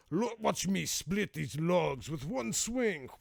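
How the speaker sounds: high, slow, hammed-up boasting; show-off woodcutter. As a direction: put on a drawn-out macho show of strength. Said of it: "they wouldn't be talking like that in Boston".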